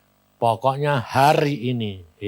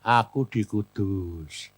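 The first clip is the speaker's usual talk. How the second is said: slow, lower answer; unhurried reply; 4 semitones lower, 4 dB quieter, much slower than usual. Retold or imitated. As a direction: draw the words out in a retold calm reply. Acted calm reply on phone. (jawaban pelan, nada rendah)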